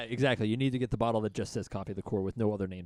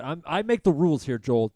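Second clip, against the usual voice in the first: mock bossy; 6 semitones higher, 9 dB louder than usual. Real, not acted. Bossing friend to use phone.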